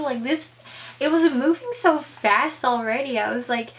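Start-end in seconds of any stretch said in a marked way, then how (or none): none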